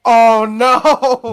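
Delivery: sudden high cry of dread; mock alarm. Real, not acted. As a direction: yelp in playful horror. Mock dread at live-action sequels.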